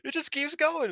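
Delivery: very high, loud astonishment; amazed outburst. Retold or imitated. Fan retelling own reaction to the beatdown, amazed. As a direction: squeal it in hyped disbelief.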